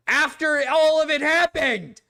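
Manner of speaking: real frustrated near-shout; exasperated outburst. Real, not acted. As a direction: vent your disbelief at how late it came. Exasperated rant about the recording.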